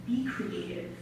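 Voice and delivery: soft, low slam instruction; quiet performed command; hushed poetic command. Acted. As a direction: say it gently into the silence. Poem command, later repeated louder.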